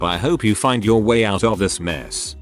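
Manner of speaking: ordinary delivery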